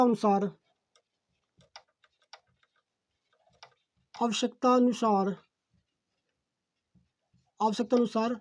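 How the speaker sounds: measured note-taking dictation, phrases repeated. Real, not acted. Teacher dictating a definition for students to write, dictation. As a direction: dictate word by word with long pauses.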